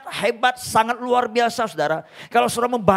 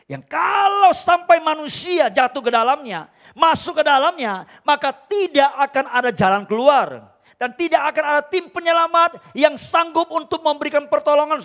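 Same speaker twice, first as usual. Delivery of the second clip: preaching at full force; 8 semitones higher, 6 dB louder than usual. Acted. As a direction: thunder it out from the pulpit. Preacher warns about hell.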